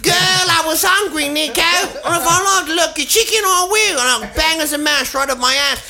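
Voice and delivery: high, sassy and defiant; sassy woman voice. Pretend. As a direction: sass back with attitude. Voicing the tattooed woman.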